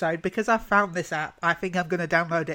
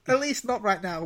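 ordinary talk

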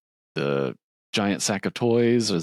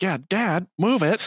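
mock yell; 13 semitones higher than usual. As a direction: shout it at Dad like a brat. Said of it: "the Grinch is like".

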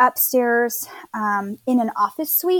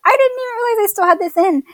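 laughing; 10 semitones higher, 5 dB louder, faster than usual. Retold or imitated. Retold surprise at mouth guard.

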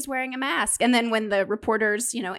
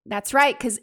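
usual speaking voice

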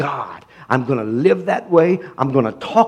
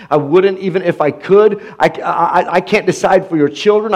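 ordinary talk, nothing marked

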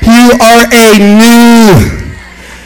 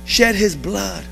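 soft intimate preaching; 4 semitones lower, 12 dB quieter than usual. Acted. Preacher asking the church why Jesus died, hushed. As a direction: drop to a hushed, gentle question. Asks congregation why, then answers.

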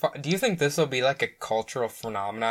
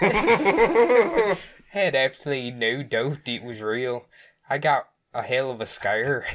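put-on wandering Scottish accent. Quoting the Scottish cop, drifting Scottish accent. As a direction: botch a Scottish cop's accent through laughter. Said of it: "kinda Scottish to Australian".

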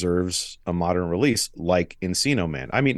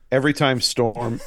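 ordinary delivery

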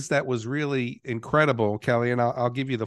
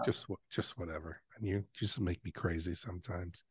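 muttered aside; 5 semitones lower, 13 dB quieter than usual. Real, not acted. Teasing mutter after accent bit.

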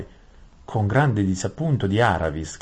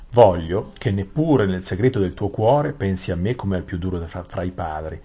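king's voice; 5 dB louder than usual. Acted. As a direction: speak as the king, contained and even. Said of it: "il re che sta parlando". (voce del re, misurata e più grave)

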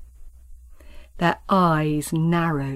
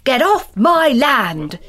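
loud slow shout; 5 semitones higher, 5 dB louder, slower than usual. Acted. Both farmers yelling at each other while grappling, loud. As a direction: yell it as loud as you can. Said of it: "all the time shouting".

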